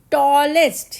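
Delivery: Tallest called out loudly, the ending EST stressed; teacher proclaims the answer loud; loud teaching emphasis. Shown as a demonstration. Teacher announcing superlative to class, loud emphatic call. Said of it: "add करेंगे हम EST".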